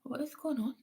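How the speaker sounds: whisper. Real, not acted. Puzzled at lingering troll.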